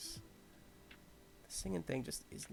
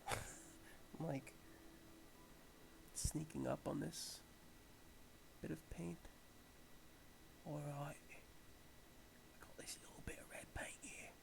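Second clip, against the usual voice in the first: mock Steve Irwin; 11 dB quieter than usual. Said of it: "I feel like Steve Irwin right now".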